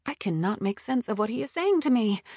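usual speaking voice

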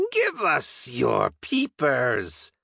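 slow, high goblin voice, falling in pitch; greedy goblin. Acted. Goblin clinging to the helm demands eyes, slow and high. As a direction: stretch out the demand like a greedy creature.

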